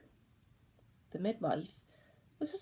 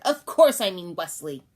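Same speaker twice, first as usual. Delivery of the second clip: loud, scornful insistence; an accusing baby, loud and scornful; 12 dB louder than usual. Acted. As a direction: throw it back at her, loud.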